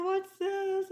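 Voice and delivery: high, loud startled question; surprised question. Retold or imitated. To doctor throwing pill boxes, startled. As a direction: sound caught off guard. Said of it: "And I said".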